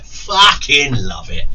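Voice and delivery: loud, slow, drawn-out gush; emphatic affection. Real, not acted. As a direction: stretch out the first word with relish. Gushes about brother's slobbery dog.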